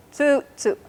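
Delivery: "Tsu, tsu" with the oo held long; Caddo word tsu. Shown as a demonstration. Teacher repeats "Tsu" for the class, long vowel clear. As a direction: stretch the oo in "Tsu". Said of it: "There's an oo and then a oo".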